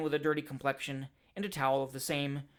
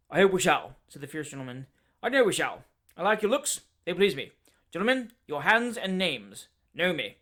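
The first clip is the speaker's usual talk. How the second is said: short, abrupt, louder sentences; loud curt Dowler; 6 dB louder than usual. Acted. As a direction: jerk out each phrase like an old soldier.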